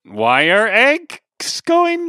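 very high, slow rising question; "going?" pulled up high and slow; uptalk. Shown as a demonstration. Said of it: "use an upward inflection at the end".

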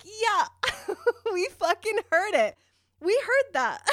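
high, giggly teasing; laughing retort, high and loud. Retold or imitated. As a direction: laugh it back at her.

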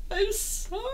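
very high, mock-crying whine; tearful apology. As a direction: imitate a drunk, weepy apology to mom. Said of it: "And I just started crying".

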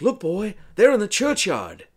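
high, loud put-on voice; urgent character call. Companion pointing Arthur to the churchyard, urgent high voice. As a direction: call out a discovery to a boy.

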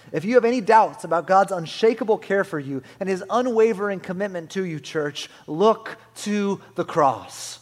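loud, high, emphatic sermon climax; impassioned pulpit exhortation; full-force preaching. Real, not acted. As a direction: preach it out with mounting conviction.

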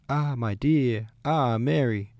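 high, loud, drawn-out greeting; joyful exclamation. A narrator's reading. Two princesses exclaim on meeting, joyful exclamation. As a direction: exclaim it fondly, swooping up high. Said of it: "they exclaimed".

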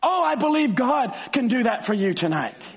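ordinary talk, nothing marked

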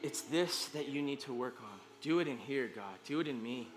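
quiet, earnest prayer to God; hushed pleading prayer; soft prayer. Pretend. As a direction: pray it softly and sincerely. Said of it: "I'm dedicated to saying".

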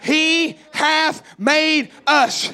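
he hath made us, shouted and very slow, every word hit; preacher pounding home a key truth; emphatic, drawn-out preaching. Shown as a demonstration. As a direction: hammer each word of he hath made us slowly. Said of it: "I'm talking to you".

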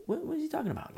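high, hushed bewilderment; baffled bystander. Acted. Bystanders asking each other about a stranger's taunt, baffled. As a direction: act puzzled in a high, small voice.

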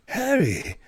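high-pitched, emphatic wandmaker greeting a customer; Ollivander wizard voice. Shown as a demonstration. Old wandmaker greeting Harry in his shop, theatrical wizard voice. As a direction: swell up high and loud like a storybook wizard. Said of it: "how to do a wizard voice".

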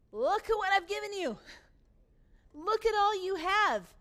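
father's loud plea. Acted. Father voiced, pushing son to see.

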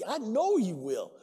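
ordinary talk

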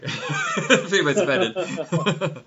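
slow words through laughter; giggling while apologizing; laughing. Real, not acted. Laughing apology after accent slip.

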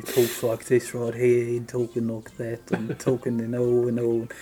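lightly put-on English accent; British accent with a joking touch; mock British accent. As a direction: imitate an Englishman talking. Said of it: "he's English, British, you know".

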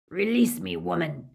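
loud, angry werewolf order; snarling demand. A narrator's reading. Trapped werewolf orders the witch to release him, loud snarl. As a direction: demand it through bared teeth. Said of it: "He bared his pointed teeth".

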